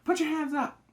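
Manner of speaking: high, loud armed command; a man ordering a hunter at gunpoint; hands-up command. Retold or imitated. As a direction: bark it at gunpoint. James and David ambush Ellie.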